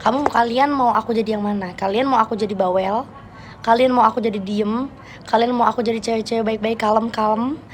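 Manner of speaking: normal talking voice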